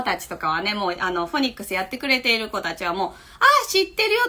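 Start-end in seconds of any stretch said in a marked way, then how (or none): 3.1-4.2 put-on high-pitched child in recognition; child who knows it. Child recognizing familiar phonics material, high and knowing. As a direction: voice a child's loud, high "oh, that one". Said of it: "っていうことだと思い".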